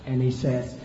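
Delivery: ordinary delivery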